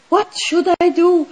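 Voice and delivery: fast, high, loud panicked question. Retold or imitated. Imagined panicked self when the friend attacks. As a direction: blurt it out in alarm. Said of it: "I would get really in panic".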